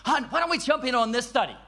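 upbeat, fast suggestion; encouraging wife. Retold or imitated. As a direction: imitate a wife eagerly urging her husband. Voices his wife coaxing him.

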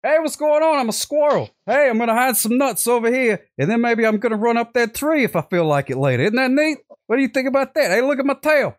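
very high, chirpy cartoon squirrel; squirrel impression. Showing the host his squirrel impression, very high cartoon squirrel voice. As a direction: play a perky little squirrel, way up high. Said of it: "I got a squirrel impression".

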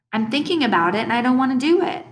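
put-on high-pitched kid refusing, rising at the end; stubborn refusal. A narrator's reading. Boy turning down his mother's request, stubborn high refusal. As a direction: dig in with a high, insistent voice.

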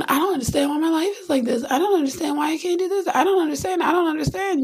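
a lightly voiced whiny complainer; whiny complaint. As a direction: whine like someone lost. Mock complaining self.

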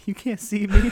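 loud hammed-up wrestler taunt; John Cena catchphrase. Mocking the man who wore it, as a loud wrestler taunt. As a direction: shout it like a pro wrestler. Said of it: "he's trying to be John Cena".

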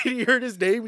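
usual speaking voice

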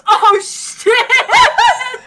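loud, high "Oh, shit!" with a laugh; startled cry, hands over mouth; shocked outburst. Acted. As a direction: blurt it out through a laugh, caught off guard. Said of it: "puts her hands to her mouth and goes".

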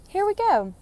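excited. Real, not acted. Starting the new journal.

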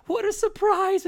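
very high, startled mother's voice; surprised old woman. Acted. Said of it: "you hear the woman just say".

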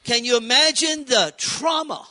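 preaching shout. Real, not acted. Preacher to congregation about being left behind: full-voiced shout. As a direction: throw the question out to the whole room.

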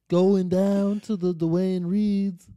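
slow, loud drawling singer impression; mock singer-songwriter drawl. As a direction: stretch it out like a piano-man crooner.

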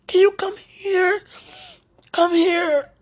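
high, tearful begging; crying plea. Real, not acted. Calling out to the absent sweetie, high and crying. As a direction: sob it out, calling him close. Begging the loved one to come.

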